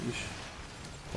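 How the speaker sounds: usual speaking voice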